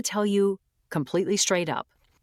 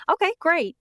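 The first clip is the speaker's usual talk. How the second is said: "Okay, great." pitched much higher, falling sharply on "okay"; sarcastic okay; 11 semitones higher than usual. Retold or imitated. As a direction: throw off "Okay, great." in mock sarcastic cheer. Sarcastic reaction to staying AI.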